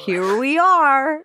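high, slow, swooping host announcement; game show host voice. Retold or imitated. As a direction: stretch it out like a hammy quizmaster. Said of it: "Monty Python game show host voice".

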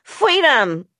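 high cry that falls away; toddler's yell. A narrator's reading. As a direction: yell it back like a fussy toddler. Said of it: "I yelled back".

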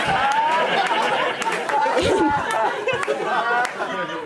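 long laugh. Real, not acted. Laughing at her own story.

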